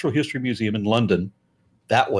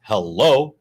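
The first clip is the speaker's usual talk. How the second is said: sarcastic hello; 3 semitones lower, 6 dB louder than usual. Retold or imitated. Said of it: "And I'm like".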